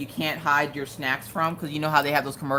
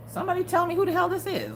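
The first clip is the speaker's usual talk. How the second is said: high, quick demand; exasperated; faster than usual. Retold or imitated. Asking chat who this is, high and fast. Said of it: "and I'm just like".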